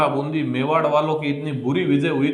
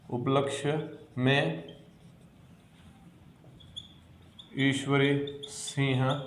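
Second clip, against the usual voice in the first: soft, slow, word by word for notes; slow dictation; 5 dB quieter, slower than usual. Shown as a demonstration. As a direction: dictate each word quietly with long pauses. Teacher dictating notes slowly.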